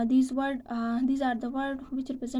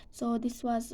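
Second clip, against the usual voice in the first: ordinary delivery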